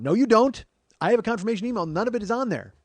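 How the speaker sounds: high, loud, heated objection; retold row at full volume; indignant rebuttal. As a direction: argue back, outraged, point by point. Arguing with company over fee.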